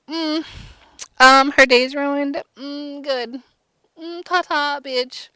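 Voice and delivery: high, smug, drawn-out purring stepmother; gloating stepmother. Retold or imitated. Stepmother gloating at Cinderella's ruined day, high smug purr. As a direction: savor her misery with a high, slow sneer.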